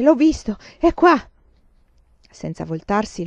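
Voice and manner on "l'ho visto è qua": plain reading voice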